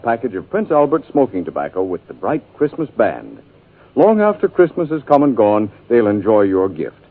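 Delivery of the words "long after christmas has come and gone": ordinary delivery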